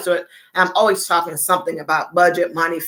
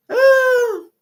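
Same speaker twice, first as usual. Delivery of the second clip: high ooh; 4 dB louder than usual. Real, not acted.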